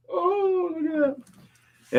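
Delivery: very high, slow, cooing pet voice; cooing at the dog. Real, not acted. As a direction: melt over the dog.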